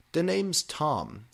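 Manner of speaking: slow, suave high-voiced line; cool boy. A narrator's reading. Tom introducing himself to the new girl, slow and suave. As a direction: drawl it coolly. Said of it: "he said".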